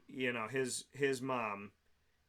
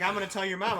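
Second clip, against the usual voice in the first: higher, louder mock tattling; whiny threat; 5 semitones higher, 6 dB louder, faster than usual. Drunk wife threatening to tell mom, mock whine. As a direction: imitate a drunk's childish threat.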